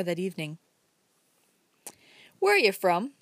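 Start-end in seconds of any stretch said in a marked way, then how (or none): 2.4-3.1 abrupt. Acted. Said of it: "asked Uncle Bobby abruptly".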